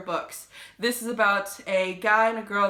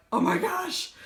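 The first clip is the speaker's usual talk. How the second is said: higher, breathless amazement; awed; 10 semitones higher than usual. Retold or imitated. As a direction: gush over a great book. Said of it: "I was just like".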